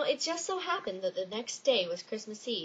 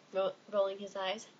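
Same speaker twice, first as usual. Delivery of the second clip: ordinary delivery, nothing marked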